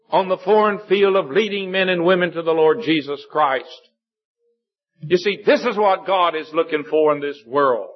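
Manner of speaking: high, ringing pulpit voice; forceful preaching. Real, not acted. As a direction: drive each phrase home from the pulpit. Preacher drives the point home.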